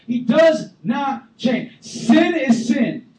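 very slow, loud, high shout; full-force preaching; emphatic preaching. Real, not acted. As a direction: pound every word. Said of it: "preacher hammers point".

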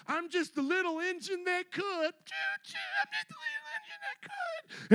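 high, sing-song little train voice; mock little engine. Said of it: "this is how you will look at religion".

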